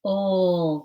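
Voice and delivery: "Ol" as one long held L sound; stretched sound. Shown as a demonstration. Teacher stretching out lemon, "Ol" held long. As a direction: sound out "Ol" slowly. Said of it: "So let's stretch it".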